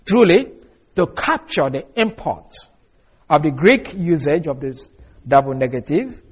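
ordinary delivery

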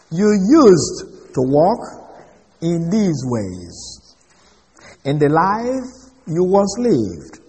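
very slow, each phrase laid out deliberately; slow scripture reading. Acted. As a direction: read the verse slowly, letting every phrase land. Reading the verse aloud.

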